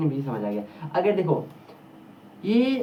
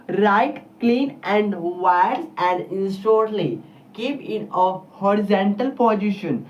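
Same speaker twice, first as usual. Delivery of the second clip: dictating notes; much slower than usual. Retold or imitated. Imitating a class teacher slowly dictating notes to be copied. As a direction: spell it out slowly for students copying. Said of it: "मैं इतना तेज बोलूंगा यार".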